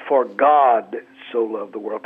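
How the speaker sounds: For God so loved the world, with God stressed. Shown as a demonstration. Pastor demonstrates Bible verse inflection, stressing God. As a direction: lean on the word God. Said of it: "inflect a different word every time".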